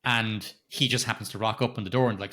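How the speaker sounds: normal talking voice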